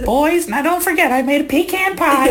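high, sweet Southern grandma voice; sweet old Southern lady. Retold or imitated. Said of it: "sweet old Southern lady voice".

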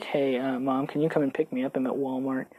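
put-on nervous kid calling his mom, very soft; sheepish. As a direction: ask mom timidly, barely voiced. Meek call to mom.

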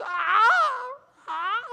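loud high strained yelps; imitated squat scream; pained cry. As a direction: yelp through the squats. Friend in pain squats.

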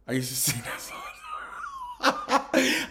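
slow, high, laughing sing-song; laughing so hard the line goes up into a squeal. Real, not acted. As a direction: crack up while stretching it out.

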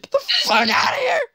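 high, loud mock scream; mom yelling. Mom screaming at kid after bleach spray. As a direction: shriek at the kid to leave. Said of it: "She was like".

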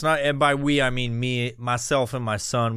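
usual speaking voice

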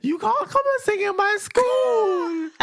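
put-on high, slow sobbing kid's wail; mock crying child. Son sobbing at dad not to sing at school. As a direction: wail it like a desperate boy.

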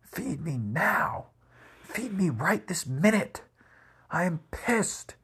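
high, drawn-out imitation of an angry little dog; put-on high voice, demanding and indignant; bossy dog voice. Storyteller voicing the hungry dog demanding dinner, high bossy voice. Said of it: "she was being bossy".

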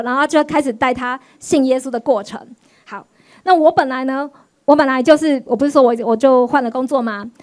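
ordinary talk, nothing marked

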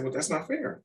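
put-on childish protest, pitched high; teasing the cashier, pitched high; mock protest. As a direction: whine like a kid who wants to shop. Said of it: "I said".

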